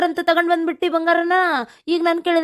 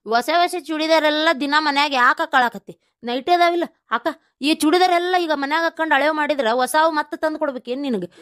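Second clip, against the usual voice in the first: normal talking voice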